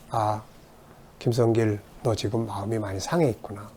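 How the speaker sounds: softly soothing yourself; gentle self-comfort. Shown as a demonstration. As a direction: speak to your own hurt heart, gently. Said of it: "그럴 때 이해를 하는 거죠".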